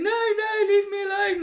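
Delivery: high, loud frightened shriek; panicked scream. Retold or imitated. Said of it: "everyone was running away, going".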